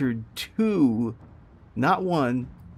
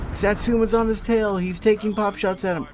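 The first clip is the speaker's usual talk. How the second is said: high, loud, repeated cries of dread; panicked live reaction, pitched way up; real alarm; 9 semitones higher, 4 dB louder than usual. Real, not acted. Gamer sees Satsuma on his tail, alarmed. Satsuma chases the destroyer being watched.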